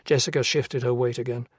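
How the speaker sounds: usual speaking voice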